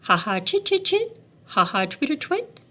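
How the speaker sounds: high, twittering swallow call. A narrator's reading. Teacher reading to class, twittering like a swallow. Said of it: "Swallows slide from under a shingle".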